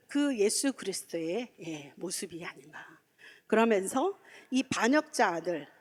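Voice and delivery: ordinary talk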